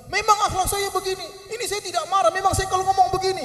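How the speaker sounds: high-pitched mock indignation; acted angry talking. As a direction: play a hot-tempered man defending himself. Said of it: "suaranya emosi kalau bicara marah-marah".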